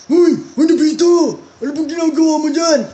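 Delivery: high loud cartoon voice. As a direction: call out like a cartoon character. Voicing Doraemon character.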